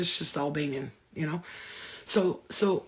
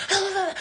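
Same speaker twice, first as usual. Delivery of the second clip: put-on frustrated kid groan; 12 semitones higher than usual. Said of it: "She was like".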